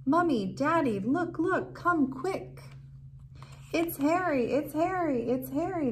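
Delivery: high, loud excited calling; gleeful kids yelling for their parents; children shouting excitedly. Acted. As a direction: call out like thrilled kids finding their dog. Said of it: "they began shouting".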